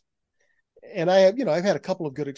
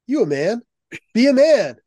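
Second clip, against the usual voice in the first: put-on brash New York accent, much higher and louder; 9 semitones higher, 10 dB louder than usual. New York information desk lady challenging the son, loud brash New York accent. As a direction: bark it like a tough New York desk clerk. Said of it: "said in her best New York accent".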